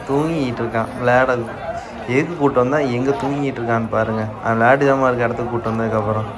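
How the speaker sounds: ordinary talk, nothing marked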